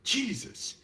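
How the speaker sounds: sudden high yelp of shock; a retold shocked exclamation; startled outburst. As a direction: jump out of your skin on it. Startled by roommate in hall.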